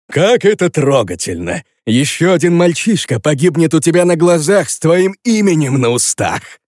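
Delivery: loud, high mocking sarcasm; sarcastic. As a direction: sneer the sympathy mockingly. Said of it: "Прозвучало очень саркастично".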